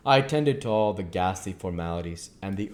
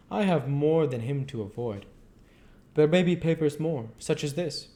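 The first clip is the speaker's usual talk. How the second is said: higher voiced, earnest foreign professor; foreign old professor; 7 semitones higher, 3 dB louder than usual. Professor explains to his colleague why he checks papers, higher and earnest. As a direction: speak as the learned foreigner, insistent.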